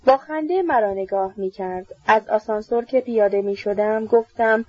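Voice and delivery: usual speaking voice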